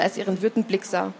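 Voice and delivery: usual speaking voice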